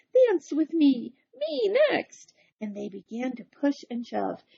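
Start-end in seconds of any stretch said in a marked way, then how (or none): 0.1-2.3 high, loud, slow demand; ladies crying out. Acted. Ladies shoving to dance with Big Anthony, high loud cry. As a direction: push to be next, loud and high. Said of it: "they cried".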